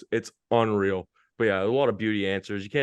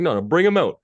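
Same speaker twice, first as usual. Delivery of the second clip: put-on higher and louder, a fired-up call to bring the kids out; excited insistence; 7 semitones higher, 8 dB louder than usual. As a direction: hype it up like you can't wait to hear them. Insisting to coaches, pumped up.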